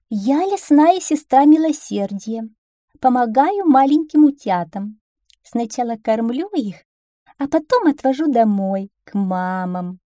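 high, loud, sing-song fox voice; sly fox feigning kindness. Acted. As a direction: sweet-talk the duckling as a sly fox. (хитрая лиса притворяется доброй, высоким голосом)

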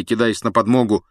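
ordinary delivery, nothing marked

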